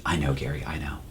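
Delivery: low, very soft imitation of a reassuring detective; quiet, low-pitched mock reassurance; hushed placating. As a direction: murmur it confidentially, as if calming someone down. Detective privately placates him in story.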